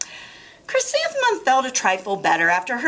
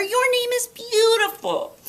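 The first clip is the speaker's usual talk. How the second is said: much higher, gentle mother voice; sweet mother; 14 semitones higher than usual. Acted. Mother comforting Chrysanthemum, high and gentle. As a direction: soothe like a loving mother.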